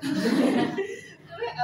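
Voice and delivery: high-pitched burst of laughter; high cackling laugh. Real, not acted. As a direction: crack up helplessly mid-story. Laughs over mouse's trapped tail.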